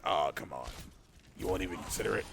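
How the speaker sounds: very low, coaxing caller voice; low-pitched phone pest; mystery caller. Acted. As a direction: drop deep to plead as the caller. Voicing the mystery caller's message.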